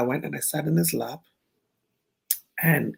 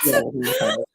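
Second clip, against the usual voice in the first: excited; 3 dB louder than usual. Retold or imitated. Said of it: "I'm like".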